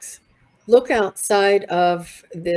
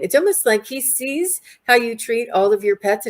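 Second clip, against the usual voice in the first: ordinary delivery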